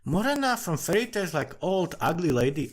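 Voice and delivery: high, scoffing retelling of the common belief; mock what people think of Morena; a lightly sneering jab at the fairy-tale hag; mocking the popular view. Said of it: "thinks like".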